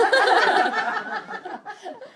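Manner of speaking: big high burst of laughter; loud laugh. Real, not acted. Laughing hard at the van prank, loud burst. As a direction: crack up loudly.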